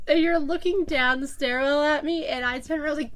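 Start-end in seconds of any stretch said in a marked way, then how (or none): none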